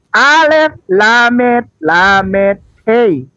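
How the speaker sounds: "Alef Lamet Lamet Hei" named letter by letter, very slowly; slow letter spelling. Shown as a demonstration. Teacher spelling Hebrew letters for viewers, drawn out slowly. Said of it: "itu di paling kanan".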